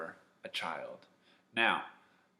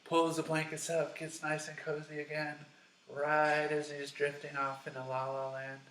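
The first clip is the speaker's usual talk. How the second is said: higher, dreamy storytelling voice; cozy, drowsy; 6 semitones higher than usual. A narrator's reading. Storyteller snuggling Samuel into bed, higher and dreamy. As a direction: drift along as if nodding off. Acts out drifting off to sleep.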